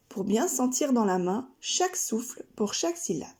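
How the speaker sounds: usual speaking voice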